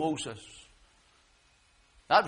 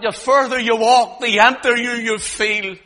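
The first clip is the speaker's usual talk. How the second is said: loud, raised preacher's voice; full-force preaching; 8 semitones higher, 11 dB louder than usual. Real, not acted. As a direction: drive it home loudly from the pulpit.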